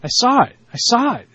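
a light Kevin Costner voice; Kevin Costner impression. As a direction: do your best Kevin Costner. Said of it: "That's my Kevin Costner".

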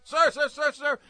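high imitated rapid "sir" calls; barrage of sirs. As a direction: rattle off sirs like a salesgirl. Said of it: "This barrage of sirs".

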